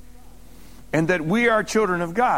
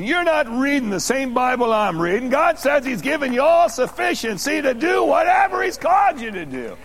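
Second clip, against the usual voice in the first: high, fervent preaching climbing to a shout; forceful rebuke; 4 semitones higher than usual. Real, not acted. Preacher rebukes the imagined complainer.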